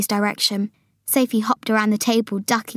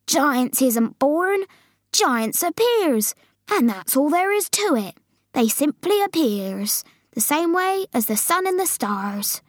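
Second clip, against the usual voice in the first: higher, drawn-out giant voice; BFG explaining; 6 semitones higher, slower than usual. Acted. Giant telling Sophie how giants appear, explaining earnestly. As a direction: explain slowly with wide swoops.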